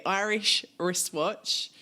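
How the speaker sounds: slow, deliberate tongue twister; each word carefully separated. Shown as a demonstration. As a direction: say it slowly to get it right. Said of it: "my favourite tongue twister".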